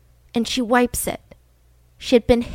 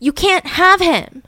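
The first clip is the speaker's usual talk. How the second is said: slow, higher-pitched warning; intimidating; 7 semitones higher, 4 dB louder, slower than usual. Retold or imitated. Betty claiming her husband from Candy, intimidating. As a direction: draw it out as a threat. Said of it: "she was trying to intimidate Candy".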